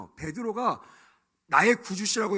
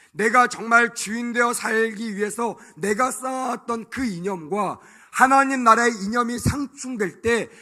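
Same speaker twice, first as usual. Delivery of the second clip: loud, slow, rising sermon climax; full-force preaching; 6 semitones higher, 11 dB louder than usual. Acted.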